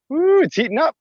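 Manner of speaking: a high, loud woo, then the words; excited whoop. Real, not acted. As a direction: cheer it out, worked up after the cardio. Coach whoops after cardio.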